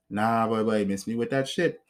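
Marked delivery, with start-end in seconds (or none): none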